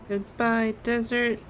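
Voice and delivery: usual speaking voice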